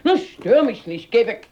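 genervt. Acted. Oma packt gereizt Koffer.

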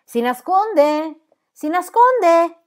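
high, drawn-out loud call; calling out loudly. A narrator's reading. Son searching the house for his mother, calling out loudly. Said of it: "chiamò ad alta voce la madre".

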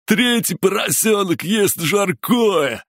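very high, drawn-out sing-song yell; slow, gleeful high-pitched wail; shrieked taunt. A narrator's reading. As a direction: shriek the nursery rhyme into his ear. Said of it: "завопил Диметрий прямо в ухо".

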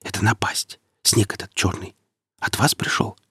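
hushed, secretive whisper; whispered, confidential question. A narrator's reading. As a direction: drop to a whisper and ask. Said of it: "Марек понизил голос до шёпота".

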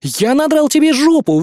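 high, forceful taunt; fury. A narrator's reading. Hero insulted by the hunter, furious. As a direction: throw it out in acted rage. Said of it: "была необузданная ярость".

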